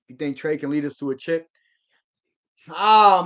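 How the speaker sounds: ordinary talk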